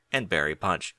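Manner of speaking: ordinary delivery, nothing marked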